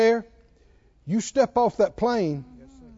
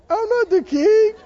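mock crying; 13 semitones higher, 6 dB louder than usual. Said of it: "Wrong answer".

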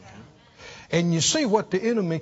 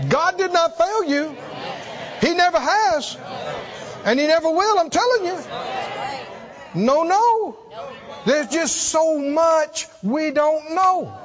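forceful preaching; 8 semitones higher than usual. Shown as a demonstration. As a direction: proclaim it with full conviction. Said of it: "oh, that's too weak".

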